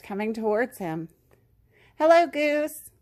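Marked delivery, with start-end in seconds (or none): 2.0-2.9 high, lifted call of hello; higher-pitched storybook call. A narrator's reading. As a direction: call a greeting across to a friend. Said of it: "the ghost called".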